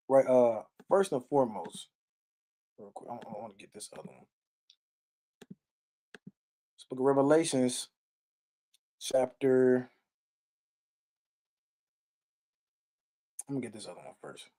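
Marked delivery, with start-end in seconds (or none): 2.8-14.4 much softer, mumbled to himself while searching; muttered aside. Real, not acted. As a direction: drop to a private mumble, off mic. Muttering aside while finding verses.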